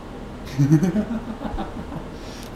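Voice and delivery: high, rippling chuckle; real laugh. Real, not acted. Podcaster cracking up over the local characters, real laugh. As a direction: laugh at your own story.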